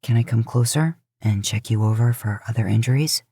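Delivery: low, soothing, taking charge of the hurt; older brother coaxing the injured moose to lie down; Dean, reassuring. Acted. Dean asks to examine him.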